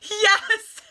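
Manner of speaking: high squealing yes through laughter; overjoyed shriek; laughing scream. Retold or imitated. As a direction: scream it while laughing and near tears.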